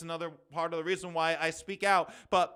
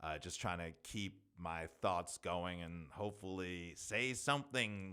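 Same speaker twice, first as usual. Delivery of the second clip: ordinary delivery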